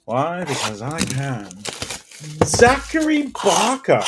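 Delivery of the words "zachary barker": slow, high, far-off announcer voice; hammed-up high announcement; mock reveal. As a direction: stretch the name like a big reveal. Dramatic card reveal.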